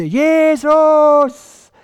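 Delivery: loud, high, long-drawn shout; lauter Schrei. Acted. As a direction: cry out the name as loud as you can. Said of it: "der hat geschrien, so laut er konnte". (lauthals langgezogen geschrien)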